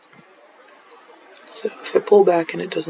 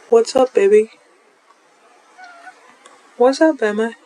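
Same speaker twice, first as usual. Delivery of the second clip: pet talk; 7 semitones higher, 4 dB louder than usual. Real, not acted. Greeting the cat lovingly.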